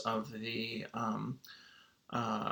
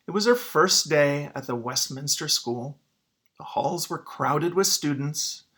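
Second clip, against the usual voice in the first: put-on clear, expressive picture-book reading for kids; story read-aloud; 2 semitones higher, 4 dB louder than usual. Teacher reading a picture book to students, expressive read-aloud. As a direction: read the story to the class, drawing them in.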